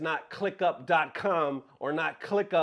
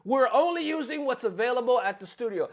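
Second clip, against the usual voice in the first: "only" said higher, in a sneering mock tone; mocking quote; 7 semitones higher, 3 dB louder than usual. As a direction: parrot the scammer's words back with scorn. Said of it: "Talk about".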